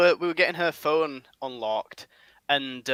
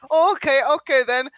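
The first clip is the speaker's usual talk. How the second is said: higher, mock vaguely Eastern accent for the shopkeeper; 9 semitones higher, 6 dB louder than usual. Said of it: "What's this accent you're doing for this guy?".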